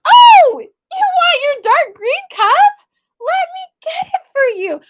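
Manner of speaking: very high, loud sing-song mommy voice; sugary gushing. Retold or imitated. Mother gushing to her daughter about the dark green cup, very high sing-song. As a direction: gush over the cup like a delighted mom.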